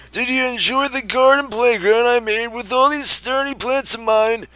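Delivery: put-on cackling old man voice. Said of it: "time to do the old man voice".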